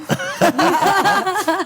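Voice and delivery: high burst of laughter; big laugh. Real, not acted. Laughs at his own story.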